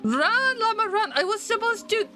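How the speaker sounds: high, frantic fleeing cries; panicked. Real, not acted. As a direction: shriek while running away. Fleeing llamas in game.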